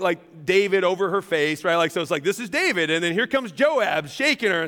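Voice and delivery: ordinary talk, nothing marked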